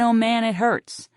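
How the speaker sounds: ordinary delivery, nothing marked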